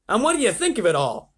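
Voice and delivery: high, loud presidential question; expectant president. A narrator's reading. President asks astronaut on air.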